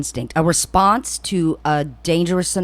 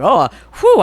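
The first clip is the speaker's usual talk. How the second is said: mock relief; 6 semitones higher, 7 dB louder than usual. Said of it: "I'm like".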